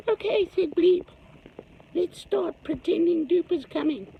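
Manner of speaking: high squeaky little creature, scheming; small creature voice. Acted. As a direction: squeak it out like a tiny plotting creature. Bleep voiced, fake scare.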